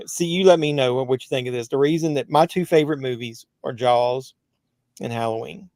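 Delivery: usual speaking voice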